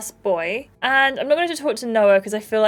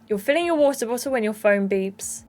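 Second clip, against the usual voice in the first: usual speaking voice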